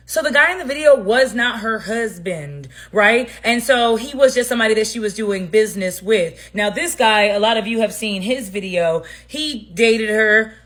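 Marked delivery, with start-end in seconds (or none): none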